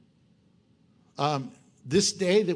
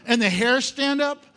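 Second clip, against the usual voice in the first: normal talking voice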